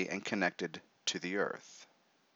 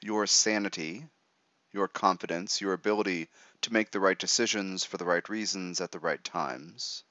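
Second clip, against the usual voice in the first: right in your ear. Acted. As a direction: talk them gently into relaxing. Leads a guided meditation.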